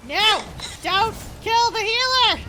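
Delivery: panicked pleading shout. Real, not acted. About to die in fight.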